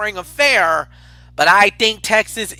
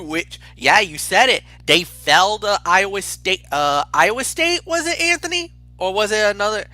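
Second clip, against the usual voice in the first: normal talking voice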